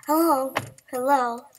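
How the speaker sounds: loud, eager hello into the phone; loud phone greeting. Acted. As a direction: answer the phone with gusto. Hero answers phone call.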